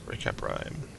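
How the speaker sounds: hushed secret whisper; barely voiced, under breath. Real, not acted. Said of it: "I might have to whisper this".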